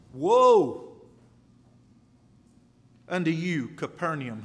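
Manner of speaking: loud, drawn-out cry of woe; thundered warning. Real, not acted. Preacher warning Capernaum from scripture, thundering. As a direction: boom it out from the pulpit.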